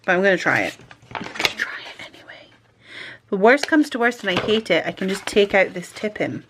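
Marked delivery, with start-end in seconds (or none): 1.1-2.5 whisper. Real, not acted. Whispered aside.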